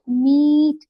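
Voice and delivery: मीट with a long held ee, light final t; long first vowel. Shown as a demonstration. Teacher demonstrating the rule, मीट with long ee. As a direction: draw out the ee in मीट, barely touch the t. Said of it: "long हो जाती है".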